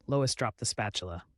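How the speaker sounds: plain reading voice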